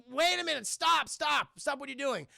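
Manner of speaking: high-pitched call to halt; urgent protest. Retold or imitated. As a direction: act out a frantic interruption. Dipper confronts the attacking spirit.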